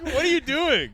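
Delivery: high, loud, incredulous what are you doing; mock outraged squeal; mock shock. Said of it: "mock incredulous".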